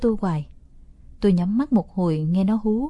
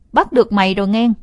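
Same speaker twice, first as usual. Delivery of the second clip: loud gloating shout; triumphant; 7 dB louder than usual. Acted. Boy springs his trap on his sister, loud triumphant gloat. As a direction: crow over the win. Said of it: "miệng cười đắc thắng".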